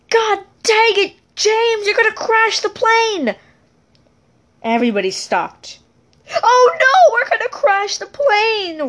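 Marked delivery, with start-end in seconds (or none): 0.1-3.4 mock panicked yell. Kid yells during pretend flight. 6.4-8.8 mock panic, very high, dripping sarcasm; sarcastic. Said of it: "Red said in a sarcastic voice".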